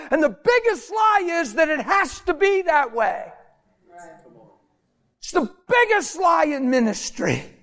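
high-pitched shouted sermon; full-force preaching. Real, not acted. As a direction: drive each point home to the congregation, impassioned.

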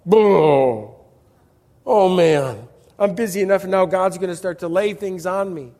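a hammed-up groaning "Boo", then mild whiny complaining; mock grumbling. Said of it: "we feel like this lady looks".

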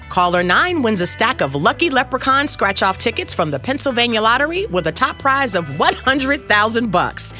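loud, high, punchy radio promo read; booming prize announcer. Acted. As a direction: sell the contest like a morning DJ.

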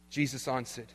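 ordinary talk, nothing marked

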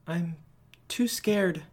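high, small frightened voice; a little kid admitting fear; scared child. A narrator's reading. Child telling dad they are too scared.

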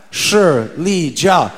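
very slow, spaced-out "Shi Li Jia"; Chinese pronunciation. Shown as a demonstration. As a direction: stretch each syllable of "Shi Li Jia" for repeating. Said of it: "Say it one more time".

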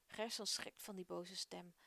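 plain reading voice

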